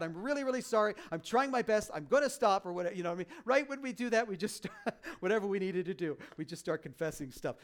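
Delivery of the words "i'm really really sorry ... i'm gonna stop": pleading confession. Pretend. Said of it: "Just tell God".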